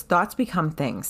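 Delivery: lightly voiced therapist. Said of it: "She goes".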